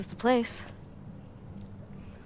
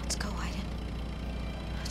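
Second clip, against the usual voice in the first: whispering; 4 dB quieter, much slower than usual. Acted. Sneaking off quietly.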